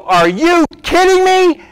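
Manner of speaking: high, slow, astonished cry; awed disbelief in heaven at the grace; amazed. Retold or imitated. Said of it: "Get to look at it and go".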